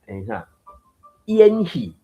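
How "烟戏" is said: 烟 said as a clear "i-an", high and loud; clear old-style IAN. Shown as a demonstration. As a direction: give 烟 its full I-A-N sound. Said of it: "读甲足明的I A N安尼". (「烟」讀甲明明的 I-A-N)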